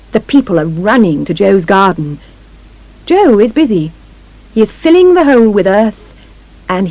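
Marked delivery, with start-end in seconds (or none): none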